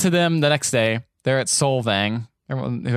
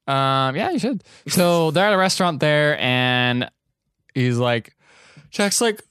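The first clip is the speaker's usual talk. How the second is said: ordinary delivery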